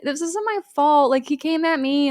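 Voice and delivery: high whiny protest; whiny complaining. Retold or imitated. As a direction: whine that it's not your fault. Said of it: "Ryan starts complaining to Jess".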